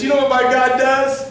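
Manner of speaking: high, loud rhetorical question; excited testimony. Retold or imitated. Stranger testifies to his helpers, high and loud. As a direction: build it up, proud and loud. Man builds to his punchline.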